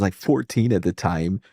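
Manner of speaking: ordinary talk, nothing marked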